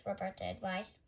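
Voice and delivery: ordinary delivery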